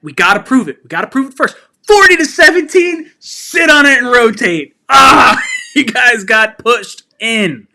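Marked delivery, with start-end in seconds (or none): 1.9-4.7 high, loud, half-crying jeer; gloating taunt. Real, not acted. Taunting rival fans after win. 4.9-7.7 loud, slow, laughing shout; laughing jeer. Real, not acted. Laughing jeer at rival fans.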